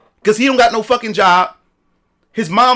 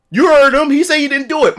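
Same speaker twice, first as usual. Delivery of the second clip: normal talking voice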